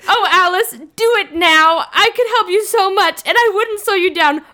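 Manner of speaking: high, loud melodramatic pleading; hammed-up begging at mock full volume. Bella begging Alice to change her on the airplane, very loud. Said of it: "this is very loud in the airplane".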